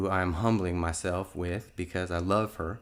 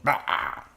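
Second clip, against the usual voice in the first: short higher-pitched mock yell; imitated demon yell; 4 semitones higher than usual. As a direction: imitate a demon's sudden cry. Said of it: "one of them yelled".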